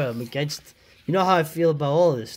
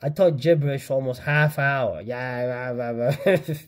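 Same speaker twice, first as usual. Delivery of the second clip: normal talking voice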